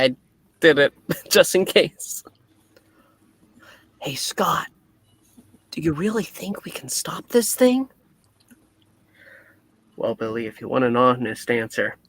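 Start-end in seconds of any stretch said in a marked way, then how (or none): 4.0-7.9 whispering. Acted. Whispered question to Scott.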